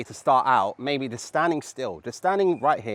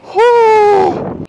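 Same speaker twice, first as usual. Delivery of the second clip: breathless whoop; 13 dB louder than usual. Real, not acted. After the skating run.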